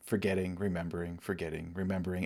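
a hushed, falling refrain; soft fading list. Acted. Listing the cycle of awakening.

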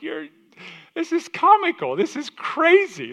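very high, slow, disbelieving voice; amazed onlooker. Retold or imitated. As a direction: gape at the talking donkey in mock disbelief. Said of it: "I'm like".

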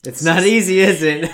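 loud, high teasing. Real, not acted. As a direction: rib her loudly. Teasing the tipsy guest.

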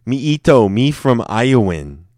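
big playful caveman-style introduction; hammed-up broken-English introduction. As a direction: proudly announce yourself in broken English. Repeats joke introduction, bigger.

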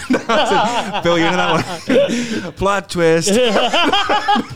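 high, loud, laughing through the words; cracking up at your own joke; real laughter breaking up the speech. Real, not acted.

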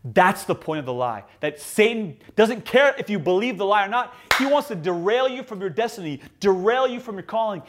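ordinary delivery

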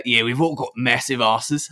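laughing through a mock Australian accent; hammy Aussie accent with a giggle; bad Aussie accent. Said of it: "we're gonna do some bad Aussie accents".

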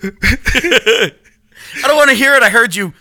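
high and loud, laughing through the words; laughing protest. Real, not acted. Laughing, he shouts down his friend's teasing. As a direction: protest loudly while cracking up.

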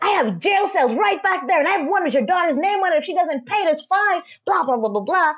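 very high-pitched imitation of a ranting officer; mock yelling. Officer threatening a mother with jail cells, mock yelling. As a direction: mimic a threatening rant, high and strained. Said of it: "he keeps yelling at her".

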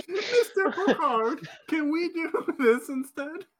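put-on childish Vulcan pleading with a laugh; toddler voice. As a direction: talk like a three-year-old asking the captain. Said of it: "they sounded like they were three".

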